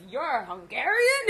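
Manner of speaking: slow, high, shouted sneer with a laugh; mocking disbelief. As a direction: scoff at her like it's absurd. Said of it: "He says".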